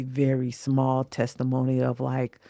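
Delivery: ordinary delivery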